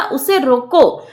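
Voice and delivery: slow "उसे रोको", drawn out as a complete command; stretched रोको. Shown as a demonstration. Teacher marking where the comma falls, drawn-out रोको. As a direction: lengthen रोको and halt right after it. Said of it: "तो रोकने के अर्थ में".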